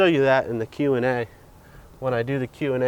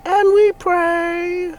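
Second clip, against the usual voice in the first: high, slow, drawn-out chant; priest voice; 6 dB louder, much slower than usual. Pretend. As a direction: intone it like a priest. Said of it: "what are you, a priest?".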